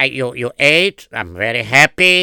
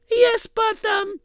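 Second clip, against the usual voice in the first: gentle wife voice; slower than usual. Acted. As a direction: ask politely in a high, hesitant voice. Wife voice, hesitant.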